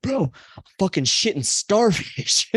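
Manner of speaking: high, slow voice of a spooked buddy; freaked-out friend. Retold or imitated. Friend telling what came out, in a high horrified drawl. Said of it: "He's like".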